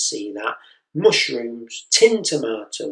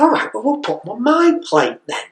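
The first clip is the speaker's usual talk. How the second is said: much higher and louder, fed up; exasperated dad; 11 semitones higher, 7 dB louder than usual. Retold or imitated. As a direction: snap it out at the end of your tether. Exasperated father.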